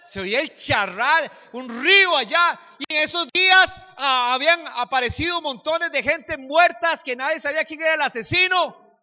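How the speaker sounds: high, very loud preaching cry; full-voiced, high-pitched alarm; dramatic shouted storytelling. Real, not acted. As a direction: shout out the danger to the congregation. Preacher builds the danger of scene.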